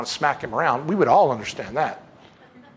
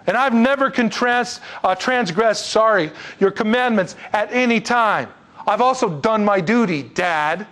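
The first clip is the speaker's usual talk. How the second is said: higher-pitched, slow aggrieved protest; disrespectful complaint; 6 semitones higher, 3 dB louder, slower than usual. Acted. Older son tells his dad he never got a goat, aggrieved. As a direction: lay out each grievance emphatically. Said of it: "There's no respect shown".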